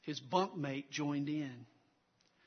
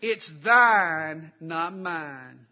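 Southern accent; 14 dB louder, much slower than usual. Shown as a demonstration. Preacher showing the congregation the pun, Southern accent. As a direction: belt out "thine" in a broad Southern accent. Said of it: "say that with a Southern accent".

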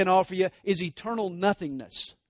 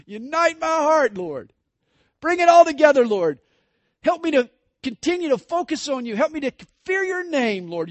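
much higher and louder, crying out to God; fervent prayer; 11 semitones higher, 10 dB louder than usual. Acted. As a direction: plead with rising passion.

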